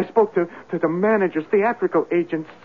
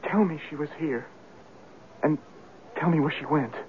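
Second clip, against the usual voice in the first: softer, lower, begging under his breath; soft pleading; 4 semitones lower, 9 dB quieter, faster than usual. Acted. Lover begs the landlady for word of Eloise, softly pleading. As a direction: plead quietly, almost to himself.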